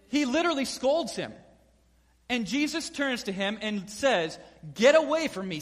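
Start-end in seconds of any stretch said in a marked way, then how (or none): 4.7-5.6 loud, high sharp rebuke; stern loud dismissal. Retold or imitated. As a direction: cast him off. Jesus rebukes Peter.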